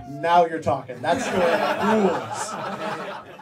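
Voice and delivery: a loud laughing, delighted approval. Retold or imitated. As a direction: cheer him on through laughter. Delighted reaction while laughing.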